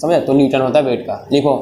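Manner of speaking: ordinary talk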